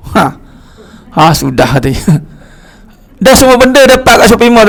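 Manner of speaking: ordinary talk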